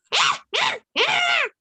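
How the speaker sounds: high, gleeful cackle; monkey laugh. Acted. Monkey mocks ogres during attack.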